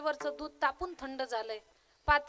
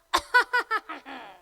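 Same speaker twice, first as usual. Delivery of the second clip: loud, long throat-clear in reply; answering cough; 7 dB louder than usual. Acted. Chanting husband replying to his wife with throat-clearing. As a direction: hack back pointedly without words. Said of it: "त्यानं पण reply दिला".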